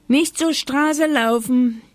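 high, loud warning call. A narrator's reading. Grandma warns her dog from the window, calling out. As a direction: call after a runaway dog. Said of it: "rief Oma Huber".

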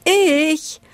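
high, quick burst of eagerness; eager volunteering. A narrator's reading. Deer volunteering to tell a story, eager.

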